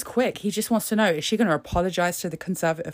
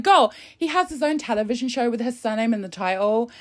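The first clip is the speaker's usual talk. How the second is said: ordinary talk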